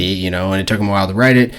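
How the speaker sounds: ordinary talk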